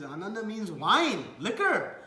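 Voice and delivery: "wine" and "liquor" punched high and loud; emphatic revelation. Retold or imitated. Nityananda explains Ananda to Chaitanya, "wine, liquor" high and loud.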